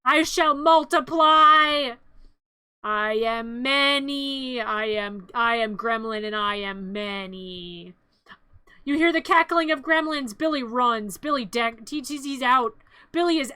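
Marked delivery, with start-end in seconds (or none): 0.0-7.9 very slow, grand proclamation; ominous gremlin. Acted. Gremlin announcing it multiplies, slow ominous intoning. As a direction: intone it like a villain.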